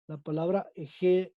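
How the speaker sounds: usual speaking voice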